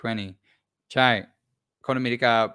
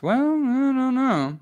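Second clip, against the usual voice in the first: bashful; 12 semitones higher, 3 dB louder, slower than usual. Real, not acted. Coy reply to a compliment.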